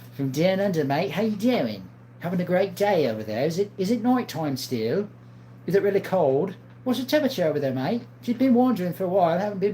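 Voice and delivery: put-on light playful Australian accent; a hint of Aussie, greeting a viewer. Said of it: "I like doing a lot of accents".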